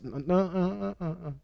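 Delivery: usual speaking voice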